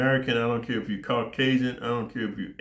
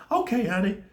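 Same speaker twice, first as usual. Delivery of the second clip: put-on girlfriend voice; 10 semitones higher than usual.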